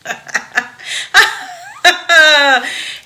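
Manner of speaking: loud, helpless fit of laughing; loud laughter. Real, not acted. Host losing it over the gringo scene, loud laughter. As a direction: laugh until you can't speak. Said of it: "eu tô rindo aqui".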